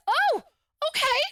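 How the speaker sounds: high, loud feigned realization; mock-surprised retort. Woman throwing his casual excuse back at him, mock-surprised.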